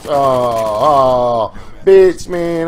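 sudden high, loud outburst at losing; frustrated yell. Real, not acted. Gamer yelling after getting killed, loud frustrated yell. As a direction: yelp out in real frustration. Gamer loses a close fight.

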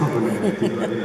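amused, laughter breaking into the words; laughing. Real, not acted. Speaker laughing about the man who refused to teach, laughing. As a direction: talk through a real laugh.

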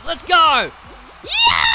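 high, loud whoop; exhilarated rallying yell; excited shout. Real, not acted. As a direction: whoop for the watching crowd. Hypes up the crowd.

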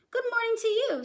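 put-on high, bright boy's good morning; a cheerful lad answering back; Jack greeting. A narrator's reading. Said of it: "said Jack".

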